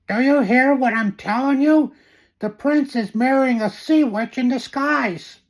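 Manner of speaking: breathless, high frantic babble. Acted. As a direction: blurt it out in a panic. Frantic news about prince's wedding.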